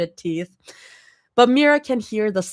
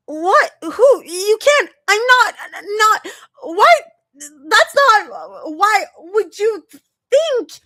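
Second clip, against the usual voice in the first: high, loud squeaking shout; panicked, flustered squeal; 7 dB louder than usual. A narrator's reading. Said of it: "as Zoe squeaks, loud".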